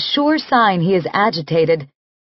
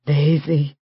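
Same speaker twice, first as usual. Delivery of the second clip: groaning her name; 5 semitones lower than usual. Acted. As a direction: sigh her name out with longing. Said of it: "he groans".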